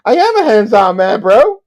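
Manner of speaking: high, loud mock-eager reply; loud high-pitched boast, climbing on the last word; Pete's eager echo. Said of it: "So Pete goes on and goes".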